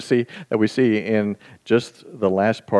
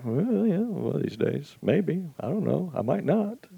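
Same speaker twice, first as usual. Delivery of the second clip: God shrugging it off; 7 semitones higher, 6 dB quieter than usual. Retold or imitated. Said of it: "and he's like".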